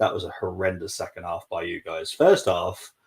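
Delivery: usual speaking voice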